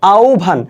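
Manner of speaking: আহ্বান said as "aubbhan" with a falling close; teacher's correct আহ্বান, "aubbhan"; correct pronunciation. Shown as a demonstration. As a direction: model আহ্বান clearly as "aubbhan". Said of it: "উচ্চারণটা কি হবে".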